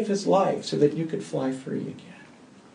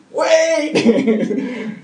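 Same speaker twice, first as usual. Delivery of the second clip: high, loud laughing cry of wait; sudden wait; 10 semitones higher, 7 dB louder than usual. Retold or imitated. Pastor halting a hurting person's apology, laughing. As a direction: cut them off mid-prayer with a laughing stop. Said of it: "I'm like".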